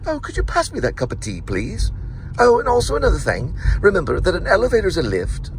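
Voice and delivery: put-on British accent. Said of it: "a British person like this".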